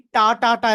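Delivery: "টা" said with full vowel, hard T; voiced ta-ta-ta. Shown as a demonstration. Teacher warns against this version, voiced ta. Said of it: "এরকম কিন্তু বলবেন না".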